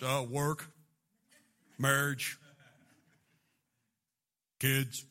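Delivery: very low, terse guy voice; low one-word answers with long pauses; boring guy impression. Imitating boring guys' prayer requests.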